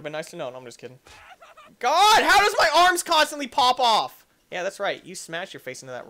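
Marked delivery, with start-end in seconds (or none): 1.8-4.3 high, loud complaint; loud incredulous outburst; exasperated yell. Real, not acted. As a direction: holler in frustration at the game. Frustrated at the game.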